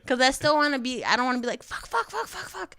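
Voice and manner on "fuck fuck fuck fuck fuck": frantic. Retold or imitated. Freaking out over a dick pic.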